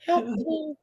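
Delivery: high squeaky plea for help; crying keyboard. Pretend. The crying keyboard begs to be let out, in a high squeak. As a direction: voice the trapped keyboard begging. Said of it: "keyboard cries when the lid is closed".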